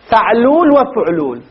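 "فعلول وفعلول" slowly as fa'lul then fu'lul; two vowel patterns. Shown as a demonstration. Teacher sums up two patterns, "فعلول وفعلول" as fa'lul, then fu'lul. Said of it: "والخرنوب اثنين".

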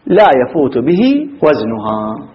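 ordinary talk

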